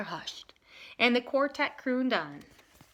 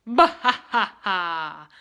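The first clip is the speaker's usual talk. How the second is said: lower, louder, slow mocking laugh; twister laughing; 4 semitones lower, 6 dB louder, slower than usual. The tornado laughs mockingly at the boy, low and loud. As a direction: laugh like a big windy bully. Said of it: "laughed the twister".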